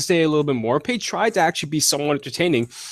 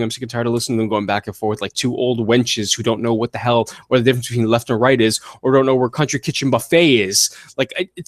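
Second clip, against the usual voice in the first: ordinary talk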